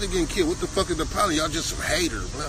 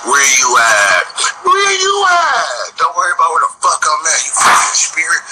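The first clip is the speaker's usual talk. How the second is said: hollering at him, much louder and higher; furious shout; 4 semitones higher, 13 dB louder than usual. Real, not acted. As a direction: yell it across the street at him. Shouting at a street figure.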